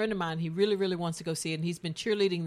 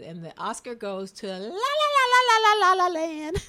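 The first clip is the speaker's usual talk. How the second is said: awards presenter; 9 semitones higher, 6 dB louder than usual. Retold or imitated. Host retells the Oscar announcement, mock grand announcement. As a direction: sing out the winner on one long high note. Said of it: "and they said".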